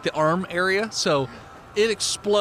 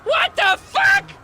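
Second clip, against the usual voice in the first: shrieking crowd; 4 dB louder, slower than usual. Retold or imitated. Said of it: "And everyone's like".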